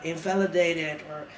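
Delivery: usual speaking voice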